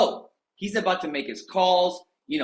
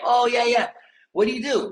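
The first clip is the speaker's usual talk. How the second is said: high, eager mock question; mock eager agents; 9 semitones higher, 3 dB louder than usual. Agent to coaches, mock eager high voice. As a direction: play the eager new agents asking. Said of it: "they're like".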